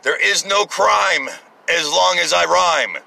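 slow, sing-song mock rhyme; mocking slow recital of the rhyme; mock rhyming quote. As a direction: recite it slowly like a smug rhyme. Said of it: "a freaking Italian rhyme".

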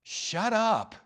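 high, firm cut-in; exasperated but caring order; exasperated command. Acted. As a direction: cut off the whining, without hatred. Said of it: "it's not a "Shut up" like, "I hate you".

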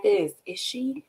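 slow, hushed, puzzled double take; soft and slow, working it out; retold disbelief. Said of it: "I was like".

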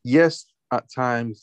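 normal talking voice

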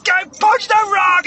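very high, loud squeal of struggle; hammed-up desperate yelling; desperate shouted protest. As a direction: wail it over and over under strain. Acted struggle at the rock.

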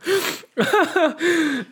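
squeaky high burst of laughter. Real, not acted. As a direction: giggle high and hard.

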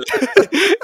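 high giggle breaking into the song; burst of high laughter. Real, not acted.

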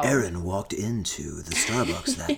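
low whispery storyteller; husky audiobook read; husky narrator voice. Acted. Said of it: "now you have, like, a husky voice".

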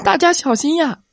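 high, loud cry of alarm; loud warning cry. A narrator's reading. Little brother warning the group, high and urgent. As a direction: call out to warn everyone. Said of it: "弟弟大声地叫道".

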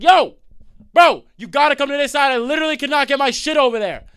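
high, loud yell to the driver; yelling at driver across the street; calling across street. Retold or imitated. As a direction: shout it over the traffic.

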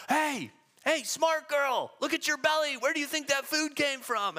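high-pitched mock jeer; imitated heckling yell. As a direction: shout a taunt from the crowd at the actress. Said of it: "They're yelling".

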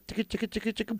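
high, soft ticka-ticka noise; rapid imitated ticking. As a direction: imitate a quick repetitive motion with ticking sounds. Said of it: "he goes".

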